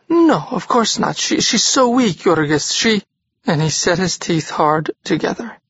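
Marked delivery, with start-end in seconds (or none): 0.1-3.0 anxious aunt. Acted. Aunt covering for Ona, stammering.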